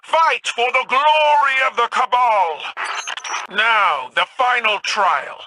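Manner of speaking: high, ringing battle call; rallying cry. Acted. Empress calling her chosen to the final trial, ringing high battle cry. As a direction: rouse the troops to fight, pitched high.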